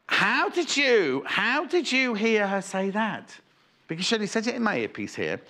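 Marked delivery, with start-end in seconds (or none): none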